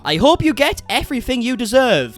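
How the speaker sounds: high, loud declaiming; theatrical verse reading; mock-poetic recital. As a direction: proclaim the lyric with grand mock weight. Reading out lyrics as mock poetry.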